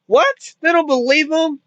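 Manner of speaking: high-pitched incredulous outburst. Real, not acted. Reviewer scoffing at a horror cliché, incredulous. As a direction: voice leaps up in exasperated disbelief. Mocks the film's disbelieving parents.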